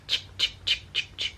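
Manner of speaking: quiet unvoiced ch ch chopping sounds; knife chopping noise. Shown as a demonstration. Demoing how the knife cut, breathy chop sounds. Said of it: "cut, like".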